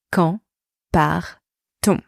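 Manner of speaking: each word set apart by pauses; slow breakdown. Shown as a demonstration. Teacher showing inversion to beginners, slow and segmented. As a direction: space it out for learners.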